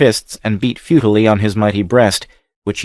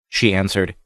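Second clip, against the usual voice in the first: usual speaking voice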